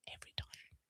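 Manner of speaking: "Every time" whispered without voice; whispered aside. Real, not acted. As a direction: whisper "Every time" holding back a laugh. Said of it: "Trying to keep a straight face".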